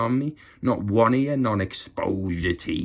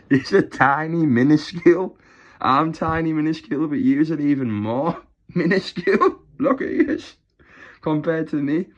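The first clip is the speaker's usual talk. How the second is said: high, loud laughing jeers; giggling minuscule; laughing mockery; 5 semitones higher, 5 dB louder than usual. Real, not acted. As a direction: taunt them through laughter. Laughing mockery of small channels.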